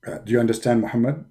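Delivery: low, hushed voice of God; mock divine whisper on the phone; deep whispered God voice. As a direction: whisper it like a solemn deity. Deity low voice.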